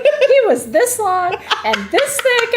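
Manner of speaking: high, slow, loud mock quote through laughter; imitating her co-host. Host quoting her co-host's fish story, laughing. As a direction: parrot her words back, hammed-up and giggly. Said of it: "She's like".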